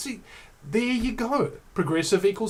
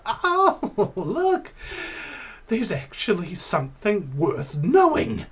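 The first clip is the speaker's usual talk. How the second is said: amazed realization; 4 semitones higher, slower than usual. Retold or imitated. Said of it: "where you go".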